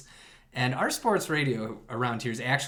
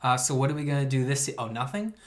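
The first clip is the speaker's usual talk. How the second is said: normal talking voice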